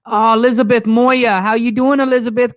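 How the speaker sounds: loud, high hello to a friend; excited greeting. Real, not acted. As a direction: call out happily as someone joins. Greeting viewers joining the live.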